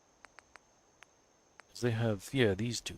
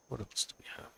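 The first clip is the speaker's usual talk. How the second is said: whispering; 11 dB quieter, faster than usual. Real, not acted. Musing while browsing build menu.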